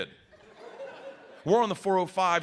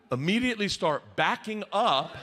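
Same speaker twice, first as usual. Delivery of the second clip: ordinary delivery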